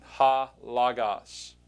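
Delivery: "Ha" with a clear breathy h before "logos", very slow; slow demo with aspirated "Ha"; rough breathing shown. Shown as a demonstration. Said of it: "notice the rough breathing mark".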